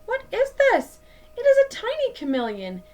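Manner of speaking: wide-eyed, then rattle off the answer; chameleon startled by a tiny friend; surprised question. Acted.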